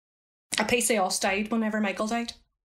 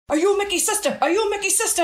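higher, urgent repeated question; urgent retold calling; 8 semitones higher than usual. Men running up to the sister, higher and urgent. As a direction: fire the question at her, pressing.

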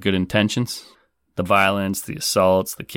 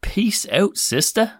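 much higher, singsong; high playful echo; 13 semitones higher, slower than usual. Real, not acted. Host echoing the caller's goodbye, high and playful. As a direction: toss the words back, high and playful. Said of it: "host echoes sign-off".

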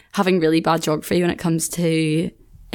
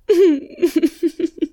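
burst of laughter; 10 semitones higher than usual. Real, not acted. Laughing at how she overreacts to a deadline email.

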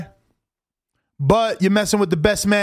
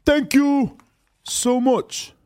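put-on English accent, pitched up; 4 semitones higher, much slower than usual. Streamer translating the boxer's speech, mock English accent. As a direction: imitate a British boxer's thanks in an English accent. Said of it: "translate what he says in an English accent".

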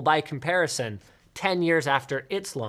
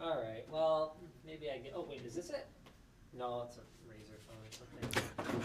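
far from mic; 4 semitones lower, 17 dB quieter than usual. Real, not acted. Host walks away searching for phone.

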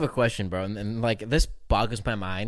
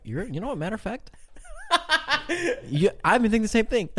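mock agreement laughing; 9 semitones higher than usual. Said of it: "I'm like".

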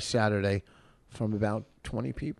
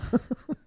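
quick high laugh; a burst of laughter; short laugh; 13 semitones higher than usual. Real, not acted. Laughs at his own joke.

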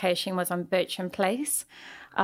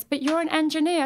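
higher-pitched mock protest; baffled client; 8 semitones higher, 3 dB louder than usual. Client to supposed engineer, baffled high protest. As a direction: object in a high, puzzled voice. Acts out Linda's baffled objection.

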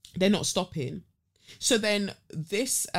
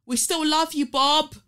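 high, loud heckle from the crowd; mimicked shout, pitched well up; imitated audience shout; 10 semitones higher, 6 dB louder than usual. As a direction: call out like an audience member to the stage.

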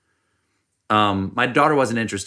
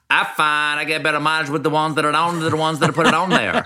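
put-on South Carolina accent; 5 semitones higher than usual. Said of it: "authentic South Carolina accent".

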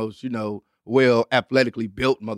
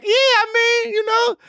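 mock crying. Panicked after taking whole bag.

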